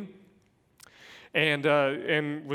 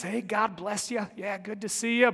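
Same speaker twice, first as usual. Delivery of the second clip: higher, bright put-on church greeting; fake cheerful pastor; 7 semitones higher than usual. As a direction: play the smiling pastor at the door, a bit hammed-up. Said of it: "Put on a happy face as a pastor".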